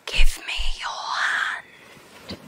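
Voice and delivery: breathy slow whisper, pulling him in; eerie whisper. Acted. Mermaid whispering to the man on the rocks, eerie and slow. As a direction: whisper it slowly like a siren's lure. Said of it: "Why are you talking like that?".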